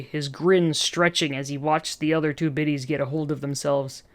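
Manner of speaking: ordinary delivery, nothing marked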